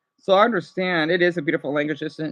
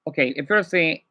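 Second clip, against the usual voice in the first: ordinary talk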